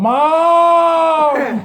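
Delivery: put-on loud high whiny kid yell; a teen hollering "Mom!" from the couch. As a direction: bellow it like a teen calling home.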